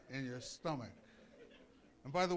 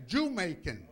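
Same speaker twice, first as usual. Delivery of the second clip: imitated yell; 3 semitones higher, 8 dB louder than usual. Preacher retelling what the lady called him, imitated yell. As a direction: repeat her shout, punching the first syllable. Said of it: "She says".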